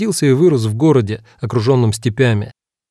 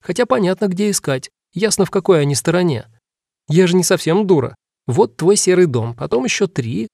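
put-on high chattering little girl; 6 semitones higher than usual. A narrator's reading. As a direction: talk like an eager small girl. Dora explains, girl voice.

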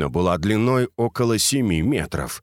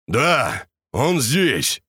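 pleased voice; 5 semitones higher, much slower than usual. A narrator's reading. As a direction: call it out with satisfaction. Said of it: "раздался довольный голос великана".

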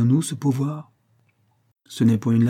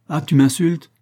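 higher and louder, indignant; offended angry cry; 6 semitones higher, 5 dB louder than usual. A narrator's reading. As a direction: flare up, insulted. Quarrel between Bouvard and Pécuchet.

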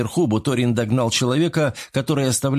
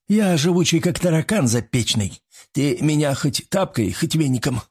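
higher, strained soldier's voice; lightly croaked bravado from a wounded man; hoarse croak; 5 semitones higher than usual. A narrator's reading. Wounded soldier joking with his lieutenant, hoarse and strained. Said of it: "прохрипел боец".

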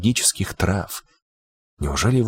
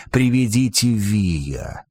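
witch's wail; much slower than usual. Acted. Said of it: "ведьма возопила".

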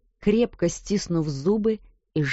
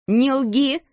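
sharp angry shout, a touch higher and louder; furious shout; 6 semitones higher, 5 dB louder than usual. A narrator's reading. Headmistress shouting at the sobbing maid, furious. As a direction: bark it at the girl. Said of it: "кричала она".

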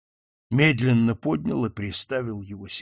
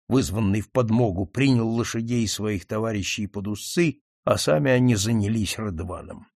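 plain reading voice

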